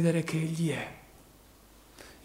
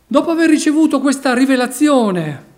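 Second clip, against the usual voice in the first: preaching at full force; 7 semitones higher, 10 dB louder than usual. Acted. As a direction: drive each phrase home like a revival preacher. Preacher exhorts congregation.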